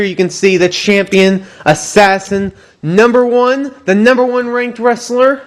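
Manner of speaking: high, hyped ring announcer voice; wrestling promo. Acted. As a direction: sell it like a big fight night.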